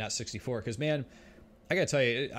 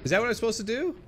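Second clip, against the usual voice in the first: much higher, louder disbelieving question; incredulous; 10 semitones higher, 5 dB louder, faster than usual. Real, not acted. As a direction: ask it in exasperated disbelief. Baffled by the map design.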